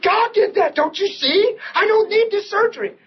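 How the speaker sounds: high, eager, insistent; excited. Retold or imitated. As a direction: push it out with rising excitement. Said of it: "I'm all excited now".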